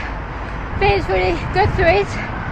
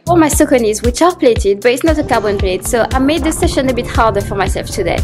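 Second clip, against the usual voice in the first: while running; 6 dB louder than usual. Real, not acted.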